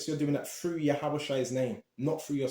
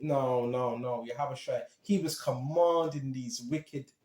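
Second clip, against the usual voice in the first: ordinary delivery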